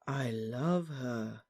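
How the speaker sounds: very slow, soft, swooning; breathless. A narrator's reading. Ron repeats his love to Harry, breathless. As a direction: sigh it out softly, in a love daze. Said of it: "repeated Ron breathlessly".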